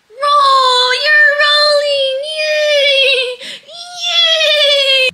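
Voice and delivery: very high, loud thrilled cheer; excited cheering. Real, not acted. Mom cheering her baby's roll, very high and loud. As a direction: cheer her on as she rolls.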